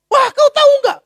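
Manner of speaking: high, loud scolding demand; angry wife. Acted. Wife scolding her husband, high and loud. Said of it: "Tapi istrinya bilang".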